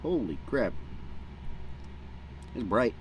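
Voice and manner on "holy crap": ordinary delivery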